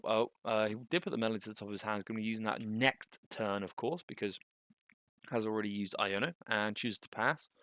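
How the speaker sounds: normal talking voice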